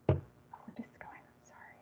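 a puzzled whisper under the breath; whispered to herself. Real, not acted. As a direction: mutter it privately, barely voiced. Presenter muttering to herself, confused.

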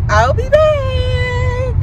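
high, long-held last word; drawn-out sign-off. Real, not acted. Vlogger signing off to viewers till later, drawn out. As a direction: stretch it out high and slow.

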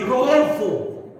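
slow, drawn-out Rodolfo like a warning; a wife scolding with the full name; slow, firm Rodolfo; stern full name. Retold or imitated. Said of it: "pero cuando está así".

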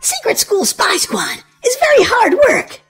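ordinary delivery, nothing marked

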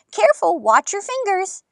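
high-pitched grandma's caution; urgent warning. A narrator's reading. Grandma warns child in story.

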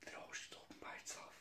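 barely audible, hurried whisper; startled whisper. Real, not acted. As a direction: whisper it, spooked by the door. Spooked by a door opening.

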